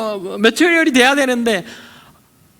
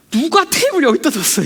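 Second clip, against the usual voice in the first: mock irritated outburst; 5 semitones higher than usual. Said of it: "이렇게 원망이 된단 말이에요".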